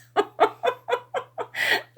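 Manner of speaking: bright high burst of laughter. A narrator's reading. Laugh between lines.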